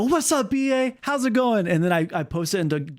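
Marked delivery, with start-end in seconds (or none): 0.0-1.7 high, hailing mock greeting; retold greeting. Streamer retells in-game message greeting, high hailing voice. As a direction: call out a greeting, pitched well up. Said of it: "I go".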